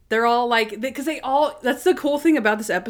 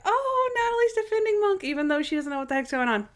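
high excited voice; 12 semitones higher than usual. Retold or imitated. Her own retold reaction.

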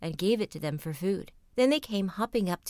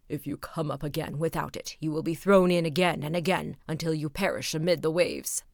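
stern king; 4 semitones lower than usual. Acted. King threatens suitor.

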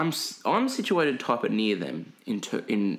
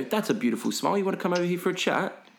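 lightly mocked English patter, quick and rising; pommy charity guy; 7 semitones higher, faster than usual.

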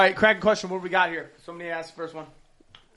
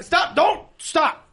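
shout; 12 semitones higher, 7 dB louder, much slower than usual. Real, not acted. Yelling at a friend across the table.

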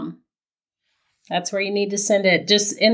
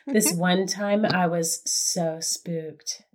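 slow, hushed phone-line come-on; mock-sultry hotline voice, drawn out; nine hundred voice; 4 dB quieter, slower than usual. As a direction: read it slowly like a late-night phone-line tease. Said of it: "like, a nine hundred voice".

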